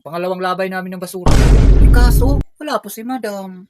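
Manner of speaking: loud complaining outburst; loud rant. Acted. Mark complaining about madam's debt, loud rant.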